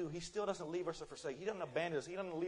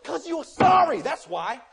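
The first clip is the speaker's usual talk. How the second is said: slow, much louder and higher shout; shouted rebuke; 10 semitones higher, 16 dB louder, much slower than usual. Acted. Pastor rebuking young men who want to preach, shouting. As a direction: slam it down on them like a verdict.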